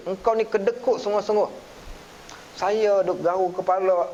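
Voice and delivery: ordinary delivery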